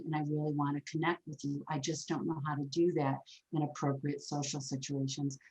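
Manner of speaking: soft, low, heartfelt plea; soft sincere apology. Pretend. Imagined neighbor Bob asking forgiveness, soft and sincere. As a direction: quietly ask to be forgiven. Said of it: "he was saying".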